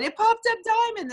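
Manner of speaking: high-pitched, thrilled retelling; excited announcement. Retold or imitated. Friend on the phone announcing the diamond rank, excited. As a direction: break the big news in a high excited voice.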